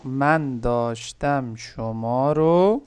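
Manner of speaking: each word of من داشتم شما رو drawn out slowly; slow careful model. Shown as a demonstration. Teacher repeats the student's phrase, slowly and carefully. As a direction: spell it out slowly for the learner. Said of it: "teacher repeats slowly".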